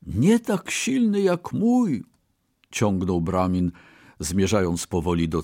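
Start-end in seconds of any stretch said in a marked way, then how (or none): 0.1-2.0 high, slow one-upping; boastful insistence. A narrator's reading. Brahmin baits the merchant, boastful. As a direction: insist with pitch raised, stretching it.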